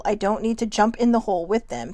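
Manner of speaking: normal talking voice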